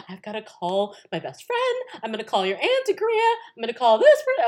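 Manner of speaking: imitated high, loud excitement; excited mom. Mom listing who she's gonna call, thrilled. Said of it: "listing who she's gonna call".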